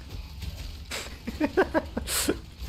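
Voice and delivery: soft, high giggling laugh. Real, not acted. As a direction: crack up quietly at your own joke. Laughs at own coworker joke.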